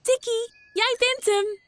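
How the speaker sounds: put-on high excited child voice calling out; bright game call to a friend; playful tag call. A narrator's reading. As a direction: shout the tag gleefully.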